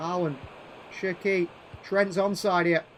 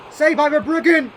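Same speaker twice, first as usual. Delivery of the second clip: louder, higher call of the save; excited call; 6 semitones higher, 7 dB louder than usual. Real, not acted. Gamer reacting to a late save, excited call.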